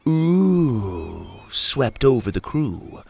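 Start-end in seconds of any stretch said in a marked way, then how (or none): 0.1-1.4 long drawn-out jeering ooh; sarcastic mock-scared ooh. A narrator's reading. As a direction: jeer like an unimpressed crew. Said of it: "A mocking".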